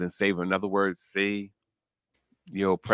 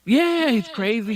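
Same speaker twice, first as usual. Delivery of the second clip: emphatic; 12 semitones higher, 4 dB louder, slower than usual. Real, not acted. Emphatic rant about filthy man.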